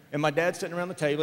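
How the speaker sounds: ordinary delivery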